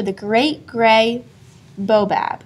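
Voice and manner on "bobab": usual speaking voice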